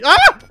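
sudden high loud yelp; startled yelp. Real, not acted. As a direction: yelp as the trap springs. Startled again by trap.

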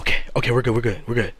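unvoiced soft reassurance; whispering while hiding. Real, not acted. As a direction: whisper to calm yourself down.

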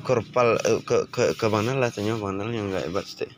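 normal talking voice

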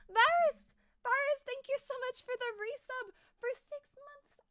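high, loud, delighted shout of a viewer's name; excited thanks. Real, not acted. Streamer thanking a viewer for the resub, thrilled. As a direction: greet the resub like a long-lost friend.

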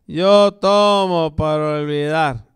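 put-on slow, drawn-out drunken repeat. Drunk at the cantina repeats himself, slow and drawn out. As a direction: drag the words like he's fading.

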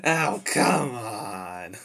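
very slow, drawn-out groan; long, pleading moan of protest; exasperated whine. Acted. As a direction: stretch each word into a complaint. Tied up, pleading with Alistair.

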